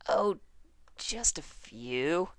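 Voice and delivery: ordinary delivery, nothing marked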